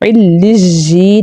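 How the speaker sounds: علجی with j replacing the doubled y; wrong pronunciation. Shown as a demonstration. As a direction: put a buzzing j in the middle of علجی. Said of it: "یہ totally wrong ہے pronunciation".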